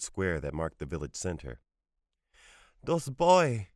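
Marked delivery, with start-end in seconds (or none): 2.8-3.7 put-on high, louder choked outburst from an upset boy; gulping. A narrator's reading. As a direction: blurt it out, choking back tears. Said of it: "he gulped".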